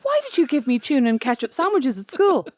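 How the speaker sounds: high-pitched mock indignant whine; acted complaint. Daughter confronting Mom over school sandwiches, acted complaint. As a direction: demand an explanation like an aggrieved child.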